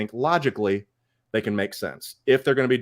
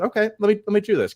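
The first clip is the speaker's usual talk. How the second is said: much higher, bright eager push; eager self-talk; 8 semitones higher, faster than usual. Retold or imitated. Fan telling co-host about pre-orders, excited higher voice. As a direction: psych yourself up to order. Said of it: "things that I said".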